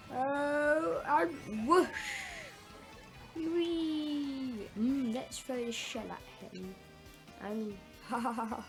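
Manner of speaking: ordinary delivery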